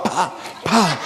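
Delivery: laughing mock nail-gun pops; nail gun sound. Joking about carpenters with nail guns, laughing nail-gun pops.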